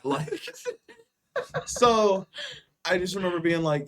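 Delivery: normal talking voice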